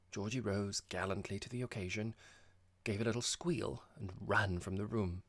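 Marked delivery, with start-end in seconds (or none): none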